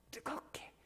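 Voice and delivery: breathy whisper of hot. Acted. Preacher whispering the word to the congregation.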